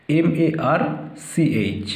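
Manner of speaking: group "এম এ আর" as one unit and set "সি এইচ" apart; letters in groups. Shown as a demonstration. Teacher showing how to spell March, "এম এ আর" and "সি এইচ" as separate groups. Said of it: "এটাকে একসাথে বলবে".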